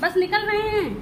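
high, carrying reassurance; calling back. Real, not acted. Answering someone waiting to leave, calling back in a high voice. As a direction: call out that you're on your way.

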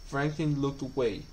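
ordinary delivery

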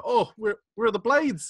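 high, loud puzzled question; mock surprise. Reliving the trailer with friend, high surprised question. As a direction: act baffled.

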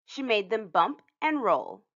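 usual speaking voice

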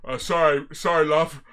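low, muted dad impression; put-on dad voice. Dad excusing himself to go see a band, low mock-dad voice. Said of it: "Your dad goes to this band's gigs".

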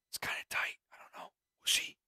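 breathy whisper to himself. Real, not acted. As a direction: whisper it like a secret. Whispered aside.

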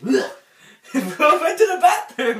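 slow laughing taunt. Real, not acted. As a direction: drag it out laughing. Laughing at friend.

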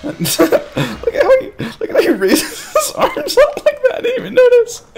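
high-pitched talk through laughter; real helpless giggling; cracking up. Real, not acted. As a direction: squeal the words out while laughing. Cracking up at enemy's arms.

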